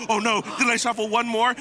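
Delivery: high, loud mock panic. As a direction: pretend to panic over a miscount. Retelling a player's shuffle worry.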